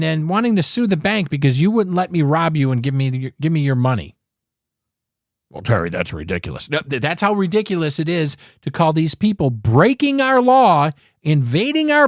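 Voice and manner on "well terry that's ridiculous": low, quicker voice of a doubting listener; imagined objector. Retold or imitated. Host voicing a listener who calls him ridiculous, low quick retort. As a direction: toss it off low and fast like a skeptical caller.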